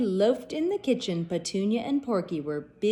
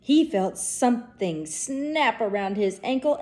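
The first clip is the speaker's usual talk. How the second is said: ordinary delivery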